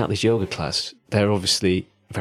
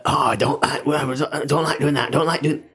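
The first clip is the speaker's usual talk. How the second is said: a lightly hammed-up Yorkshire moan; 3 semitones higher than usual. Host mocking himself, moaning in a hammed-up Yorkshire accent. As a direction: play up the Yorkshire accent while grumbling. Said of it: "hamming up my Yorkshire accent".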